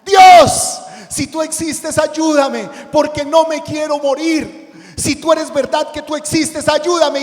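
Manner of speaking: screaming high for help into the dark; a falling climber crying out to God in terror; desperate shout. Acted. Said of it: "pegó un grito".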